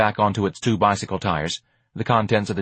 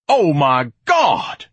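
loud, drawn-out, higher exclamation; thundering; 7 semitones higher, 6 dB louder, much slower than usual. Acted. Ignatius aghast at his day, thundering. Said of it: "Ignatius thundered".

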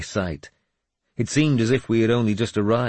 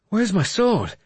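high, alarmed question; panicked; 4 dB louder than usual. A narrator's reading. Wounded lord missing his sword, asking in panic. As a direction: blurt it high in sudden panic. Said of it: "I asked in panic".

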